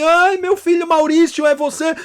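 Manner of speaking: a frightened mother grabbing at hope; desperate parent. Retold or imitated. Mother answering the scam call, frantic and high.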